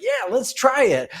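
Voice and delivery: high, slow, keen voice of a game AI sidekick; eager chatbot voice. Retold or imitated. Drudini the AI agreeing to a cowboy theme, high and eager. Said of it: "He's like".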